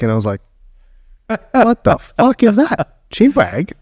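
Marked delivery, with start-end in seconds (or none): 1.5-3.7 high-pitched, drawn-out retold bafflement; incredulous, laughing mock bewilderment; baffled disbelief. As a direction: act out being thrown by a strange phrase. Retells own baffled reaction to slang.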